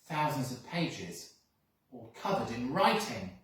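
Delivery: ordinary delivery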